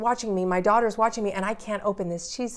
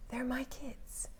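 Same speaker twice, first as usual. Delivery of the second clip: slow, intense whisper, a little higher; hushed realization; 4 semitones higher, 13 dB quieter, slower than usual. Retold or imitated. Mother struck to her core about her children, hushed and intense. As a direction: whisper it like a truth that just hit.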